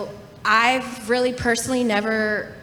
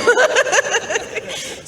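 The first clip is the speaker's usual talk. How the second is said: much higher, louder, dissolving into laughter; laughing punchline; 14 semitones higher, 6 dB louder than usual. Real, not acted. Woman finishing her comeback, laughing punchline. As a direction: let the word crack into a laugh.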